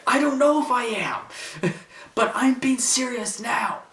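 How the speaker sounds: high, strained pleading voice; Paul Heyman voice. Retold or imitated. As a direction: plead high with a laugh breaking in. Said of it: "when he says, like".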